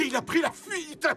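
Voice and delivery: angry shout at the cat; indignant outburst. Acted. Trapped man shouts angrily about the devilish cat.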